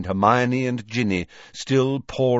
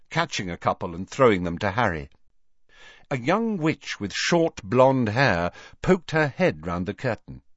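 ordinary delivery

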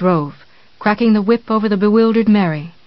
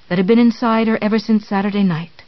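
ordinary delivery, nothing marked

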